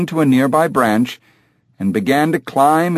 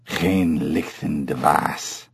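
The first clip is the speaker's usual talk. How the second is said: low, slow orangutan utterance; throaty animal speech; guttural ape grunts; 7 semitones lower, 6 dB quieter, slower than usual. A narrator's reading. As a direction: grunt it deep and soft from the throat. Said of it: "a sequence of guttural grunts".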